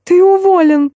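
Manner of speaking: high-pitched squeal of a furious boss; shrieking. A narrator's reading. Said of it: "взвизгнула". (визгливый начальственный выкрик)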